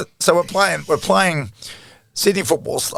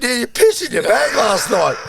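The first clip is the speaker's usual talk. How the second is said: laughing; 9 semitones higher, 4 dB louder than usual. Retold or imitated. Retelling telling roommate, laughing.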